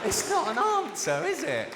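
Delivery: high, slow incredulous protest. Real, not acted. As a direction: stretch it out in disbelief. Incredulous rhetorical punchline.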